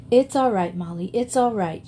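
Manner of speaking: ordinary delivery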